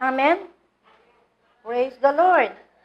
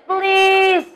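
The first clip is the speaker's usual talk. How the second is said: pleading cry; 4 semitones higher than usual. Retold or imitated. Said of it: "Umiiyak ang Panginoong Hesus".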